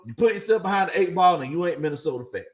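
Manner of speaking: normal talking voice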